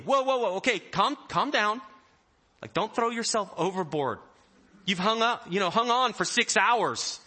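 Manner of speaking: loud, high, urgent soothing; calm them down over the storm; Jesus hurrying to settle his panicked friends. Acted. Jesus calming terrified disciples.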